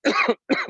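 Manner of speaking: loud unvoiced cough. Real, not acted. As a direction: cough out the irritation. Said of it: "It's very irritating".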